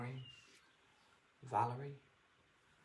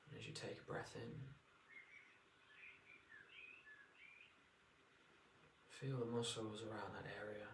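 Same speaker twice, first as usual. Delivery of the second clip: guided meditation; 5 dB quieter than usual. Acted. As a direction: ease them into relaxation.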